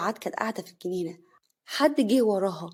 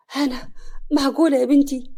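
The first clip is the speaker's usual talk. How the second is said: higher, louder stunned disbelief; shocked; 4 semitones higher, 6 dB louder than usual. A narrator's reading. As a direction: gasp it out as if she can't believe her eyes. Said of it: "سعاد بصدمة".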